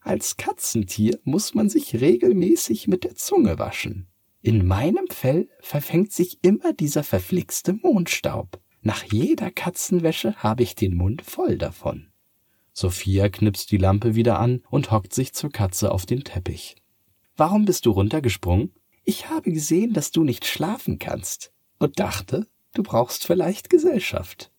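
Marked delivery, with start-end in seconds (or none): 0.0-12.1 much higher, chatty cat; a cat explaining its grooming. Acted. 17.4-18.7 put-on fast, higher, louder girl's question; eager girl. Sophia asks eagerly. 19.0-24.5 much higher, kind cat; a caring cat keeping her company. Acted. Cat explains kindly.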